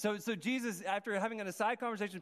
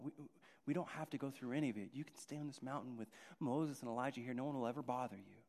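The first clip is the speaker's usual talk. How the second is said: low, much softer coaxing; soft coaxing tempter; 6 semitones lower, 7 dB quieter, faster than usual. Acted. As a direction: lure him gently to stay.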